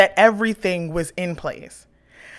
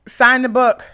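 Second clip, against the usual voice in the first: loud, higher, dismissive mock of the front desk staff; curt receptionist; 6 semitones higher, 8 dB louder than usual. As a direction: bark the order like a bored clerk. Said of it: "they're just like".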